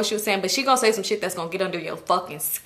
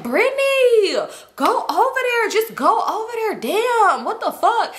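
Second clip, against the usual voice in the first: much higher, whiny demanding friend; mock pushy friend; 11 semitones higher, slower than usual. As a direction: whine it out way up high, pushy. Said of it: "She like".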